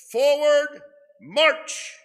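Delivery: barked high, loud marching order; drill sergeant command. Retold or imitated. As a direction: shout it like a platoon leader. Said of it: "likened to a drill sergeant".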